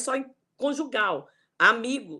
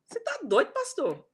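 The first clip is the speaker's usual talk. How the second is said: pitched up, indignant "are you crazy"; imitating an outraged church member, much higher; mock disbelief; 9 semitones higher than usual. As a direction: scoff at the pastor in fake shock. Imagined congregant scolding the pastor.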